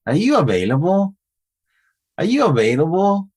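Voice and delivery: high question, AVAILABLE climbing to the end; keeps going up. Shown as a demonstration. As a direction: keep the pitch rising through AVAILABLE. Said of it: "It just keeps going up".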